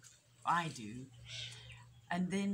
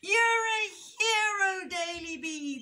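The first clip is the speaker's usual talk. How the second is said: high, loud cheer; jubilant cry; 12 semitones higher, 9 dB louder, slower than usual. A narrator's reading. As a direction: cheer it out, stretched and triumphant. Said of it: "they cried".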